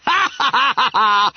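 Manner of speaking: high acted guffaw; yelled laughter. A narrator's reading. The form laughs at Bunter's cheek, yelling. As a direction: roar with laughter as a whole class. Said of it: "yelled the remove".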